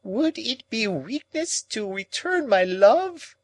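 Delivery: put-on high, slow, pleading old woman's cry; Miss Havisham exclaiming. A narrator's reading. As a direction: exclaim it as the desperate old lady. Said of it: "exclaimed Miss Havisham".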